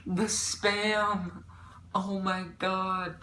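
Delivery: slow, drawn-out horrified lament; dramatic dismay. Real, not acted. Telling viewers about the worst part, slow dramatic dismay. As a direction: stretch the words out in hammed-up disgust. Dwelling on horror of the Spam.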